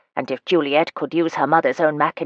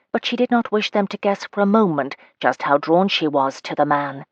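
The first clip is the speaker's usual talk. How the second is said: usual speaking voice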